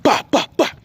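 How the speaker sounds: high mock whacking sounds; playful high smack sounds; ruler smack noises. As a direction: imitate the ruler cracking down on a palm.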